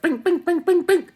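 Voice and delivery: very high, loud sing-song bings; ding like a slot machine; gleeful bing. Retold or imitated. Comic mimicking his craving, very high and loud. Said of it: "I was like".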